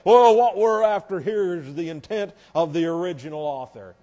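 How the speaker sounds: loud mock-authoritative voice; pompous preacher parody. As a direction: mimic preachers grandly announcing their aim. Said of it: "guys who'll stand up there and say".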